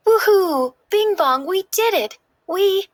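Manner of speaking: high, loud joyful whoop; excited cheer. Acted. Joy cheering to Bing Bong after landing, high excited whoop. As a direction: celebrate at the top of your voice.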